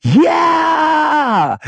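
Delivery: high shouted cheer; hammed-up ecstatic yell; excited yell. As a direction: yell it out with joy. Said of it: "screaming and yelling".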